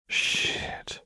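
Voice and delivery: breathy under-the-breath swear; muttered curse. A narrator's reading. Vi cursing quietly at the dead enforcer. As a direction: mutter it low to yourself. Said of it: "Vi muttered".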